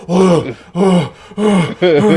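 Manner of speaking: mock gasping for air; acted-out hyperventilating; breathless gasps. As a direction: heave big panting breaths, imitating a winded man. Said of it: "He can't even breathe".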